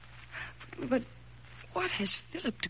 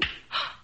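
shocked gasp; 4 dB quieter than usual. Acted. Slapped by Flora.

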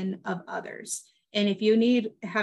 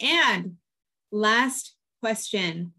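slow; much slower than usual. Real, not acted. Drawn-out quiz-show lead-in.

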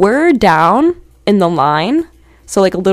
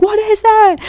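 high-pitched retold shriek; mock scream; 13 semitones higher than usual. Retelling screaming at the strange object, mock high scream. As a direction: squeal it high, imitating your stunned self. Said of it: "and I'm screaming".

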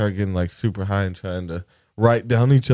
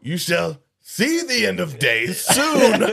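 hell beast voice; 8 semitones higher, slower than usual. Pretend. Playing hell beast back.

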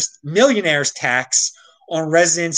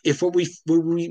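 ordinary delivery

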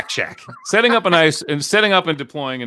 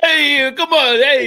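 high, loud hammed-up Joe Pesci "hey, come on"; a laughing, overdone New York tough-guy bark; Joe Pesci impression; 12 semitones higher, 5 dB louder, slower than usual. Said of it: "Who was it, Joe Pesci?".

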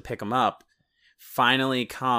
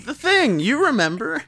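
much higher, louder insistence; mock insistent; 13 semitones higher, 5 dB louder than usual. Vague improv partner pressing the point, loudly. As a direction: insist cryptically. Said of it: "it's like".

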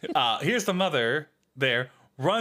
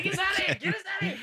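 shout; 13 semitones higher, much faster than usual. Real, not acted. Shouting playfully to end show.

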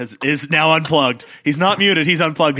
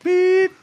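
beep sound; 3 dB louder than usual. Pretend. Imitates censor beep.

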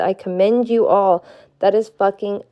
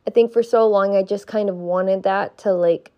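ordinary delivery

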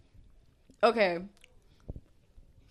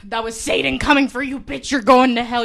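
loud mock preacher; damn her loudly, playfully; mock doom; 11 dB louder than usual. Mock damnation.

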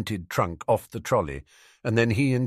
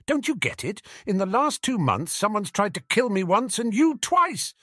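high-pitched, urgent insistence; agitated, pitch well up; forceful; 12 semitones higher, 3 dB louder than usual. A narrator's reading. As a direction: drive the point home forcefully. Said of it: "exclaimed Jim forcefully".

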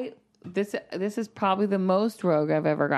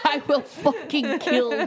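laughing mock threat; 6 semitones higher, slower than usual. Repeated threat through laughter.

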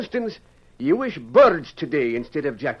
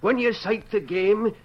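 usual speaking voice

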